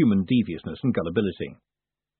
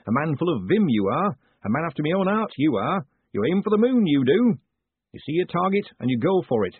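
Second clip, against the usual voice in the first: higher, bouncy small-town mayor; eager mayor; 5 semitones higher than usual. Acted. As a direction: gush admiringly in a lilting character voice. Narrator voices the eager mayor.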